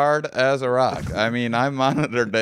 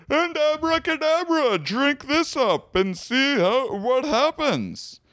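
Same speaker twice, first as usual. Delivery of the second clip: game show announcer; 12 semitones higher than usual. Acted. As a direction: boom it like a prize announcer. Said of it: "You sound like a game show announcer".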